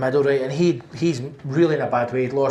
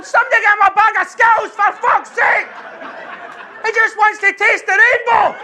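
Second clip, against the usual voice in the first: high, loud exasperated yell; exasperated shout; 7 dB louder than usual. Retold or imitated. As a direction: yell across the ward, fed up. Shouting at ward in frustration.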